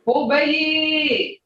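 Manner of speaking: "obey" held long and pitched high, falling; drawn-out obey. Shown as a demonstration. Teacher shows a far distance, drawn out high. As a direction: stretch "obey" out high for sixty miles. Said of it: "like, sixty miles".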